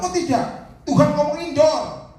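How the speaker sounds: high, loud and emphatic; loud insistence. Retold or imitated. Preacher retelling his answer to the father, loud insistence. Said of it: "Saya bilang".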